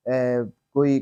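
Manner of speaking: ordinary delivery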